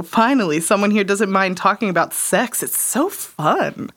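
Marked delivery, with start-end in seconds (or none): none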